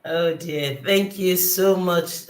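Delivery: ordinary talk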